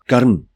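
"karm" said as in Hindi; one-syllable Hindi "karm"; Hindi pronunciation. Shown as a demonstration. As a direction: pronounce "karm" the Hindi way, no final vowel. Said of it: "in Hindi".

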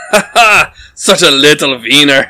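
mocking laugh. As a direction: jeer at him loudly.

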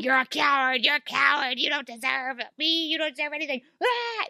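high, strained mock tantrum; imitated screaming. Abandoned son to his father, imitated screaming. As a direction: imitate a boy hurling accusations, ending in a cry. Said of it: "he's screaming that hard".

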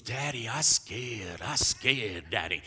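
put-on small child's frightened plea, drawn out; slow, babyish "I'm scared" voice; scared little boy. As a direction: imitate a toddler running scared to Dad. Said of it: "So he runs to me".

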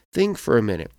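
normal talking voice

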